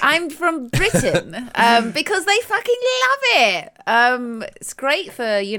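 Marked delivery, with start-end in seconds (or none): 0.0-3.7 high, loud, plummy British voice for Americans; posh British accent. Retold or imitated. Playing up a posh British accent to charm Americans. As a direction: lay the posh Brit on thick. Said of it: "very well spoken".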